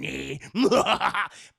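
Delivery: loud short villain cackle; evil laugh. Retold or imitated. Villain sealing the hero's fate, loud evil cackle. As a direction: cap the threat with a gloating laugh.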